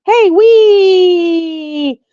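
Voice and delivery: high, loud playful whee; gleeful squeal. Retold or imitated. Dog flinging the pile about, imitated gleeful squeal. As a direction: imitate a dog having a wild time.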